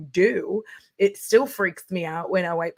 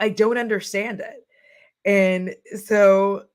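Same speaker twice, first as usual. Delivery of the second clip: ordinary delivery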